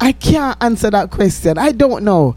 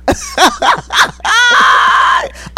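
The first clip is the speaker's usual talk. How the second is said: a burst of high shrieking laughter; 14 semitones higher than usual. Real, not acted. Friend teases her, she shrieks with laughter.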